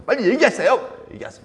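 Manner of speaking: high, loud impatient demand; acted pestering. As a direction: badger him to tell at once. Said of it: "막 때려 쓰는 거예요".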